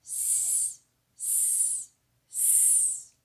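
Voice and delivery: soft drawn-out hiss; quiet snake hissing. Pretend. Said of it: "Hear its funny sound".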